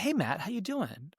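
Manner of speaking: lightly voiced friendly AI lady; nice lady voice. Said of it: "this very nice sounding lady".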